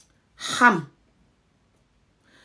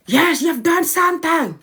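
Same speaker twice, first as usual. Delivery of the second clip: acted accusing reply; 8 semitones higher than usual. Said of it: "the person might tell you".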